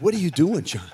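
startled mom busting her son; mom scolding. Retold or imitated. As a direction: catch the kid red-handed, loudly. Mom catching him playing priest.

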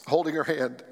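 low, halting telling of a death; choked-up, subdued storytelling; real emotion held back. Real, not acted. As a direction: get through it in short, careful pieces. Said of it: "the reason I'm emotional".

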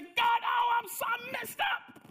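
high, loud, breaking shout of despair; desperate cry to God; anguished cry. Acted. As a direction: wail it out as if crushed by guilt. Sinner cries out to God.

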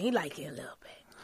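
stage-whisper it to the room; whispered aside. Real, not acted. Host whispers a teasing aside about the guest. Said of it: "He do like a little attention".

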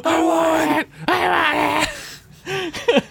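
very high, strained mock shouting; hammed-up frenzy. Worf choking Crusher and insisting he won, high mock frenzy. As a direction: screech it like a comic tantrum. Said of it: "He fucking starts choking her".

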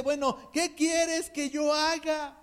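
slow, yielding tone; slow, humble plea; humble slow question. Retold or imitated. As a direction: surrender it slowly to the Lord. Pablo's humble surrender quoted.

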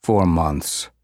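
flat, like reading off paper. A narrator's reading. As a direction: read it off a page, no feeling. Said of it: "Pop says in this, like, flat voice".

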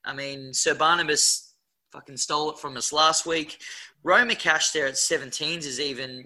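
normal talking voice

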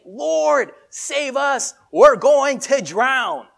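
high, loud desperate plea; panicked cry. Acted. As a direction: cry out for rescue over the storm. Disciples panicking in storm.

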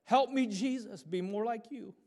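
high, pleading imitation of a little boy; mock childish plea, loud at first; child crying out. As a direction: imitate a small child calling out to Jesus. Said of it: "He began to cry out".

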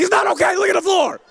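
exasperated dad pointing at the mess; frustrated protest. Retold or imitated. His retold frustrated reply.

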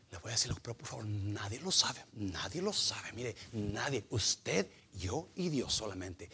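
low, hushed secret-sharing; confidential. Acted. Gossip shares a secret with a friend, confidential hush.

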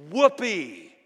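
loud, high burst of celebration; emphatic whoopee. Shown as a demonstration. Preacher translating amen for the church, emphatic and loud. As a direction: let the whoopee ring out emphatically. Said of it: "Yeah, it's emphatic".

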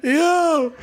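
ordinary delivery

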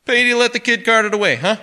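high, booming joviality; loud and affable. A narrator's reading. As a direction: make a very loud, chummy joke. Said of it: "Very loud and affable".

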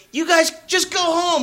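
high, easygoing boss telling them off; a light imitation of the cool boss sending them home; mild mock boss; boss voiced. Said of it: "he goes".

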